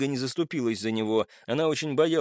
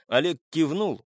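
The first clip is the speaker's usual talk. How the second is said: plain reading voice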